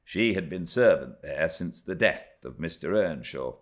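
usual speaking voice

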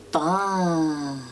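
plain reading voice

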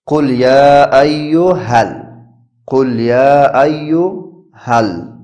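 each syllable stretched slowly; slow drawn-out spelling. Shown as a demonstration. Teacher sounds out the verse slowly for students, drawn out. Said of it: "یا پیشو هلان زبر هل".

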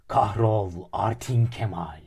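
slow, loud chanted crowd curse; crowd shouting. Acted. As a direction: yell it out like an angry mob, drawn out. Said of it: "başladı bağırmaya". (öfkeli kalabalık gibi yavaş ve yüksek sesle bağır)

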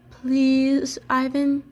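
lightly voiced pleading youngster; pleading young elephant. A narrator's reading. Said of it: "Ruby pleads".

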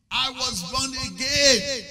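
slow, loud, high proclamation; proclaimed loud. Real, not acted. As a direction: cry it out to the congregation. Preacher repeats the line for emphasis.

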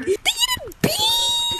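very high, stretched-out squeal; long, high cry of delight; excited squeal. Real, not acted. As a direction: squeal and hold the last word. Exclaims over beans in update.

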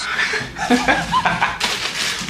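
high, breathless cackling; wheezing laugh. Real, not acted. Streamer cracking up mid-story, wheezing laugh. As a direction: dissolve into soft wheezes.

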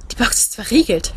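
ordinary delivery, nothing marked

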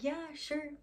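high, over-nice reassurance; fake friendly. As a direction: imitate someone being sweetly polite to your face. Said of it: "trying to be friendly to you".

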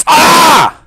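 sharp high yelp of pain; pained cry. Real, not acted. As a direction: yelp out suddenly. Sudden pain scream in game.

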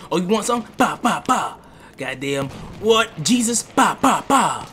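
loud, high taunting with gun noises; mock taunt. Gamer taunting a dead player, loud and high. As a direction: taunt the target, then spit out mock gunfire. Taunting targets while shooting.

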